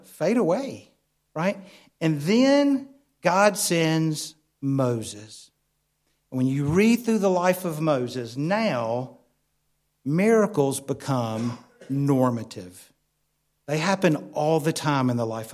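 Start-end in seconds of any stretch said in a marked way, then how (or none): none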